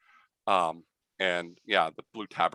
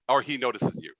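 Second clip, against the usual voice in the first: usual speaking voice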